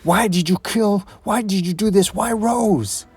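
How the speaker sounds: high-pitched, pleading outcry; grief-stricken questioning; anguished demand. Acted. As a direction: demand to know why, in anguish. Slade confronts ex-wife over Rose.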